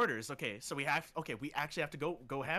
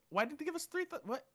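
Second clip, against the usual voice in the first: surprised; 12 semitones higher, 4 dB quieter than usual. Real, not acted. Shocked at the score.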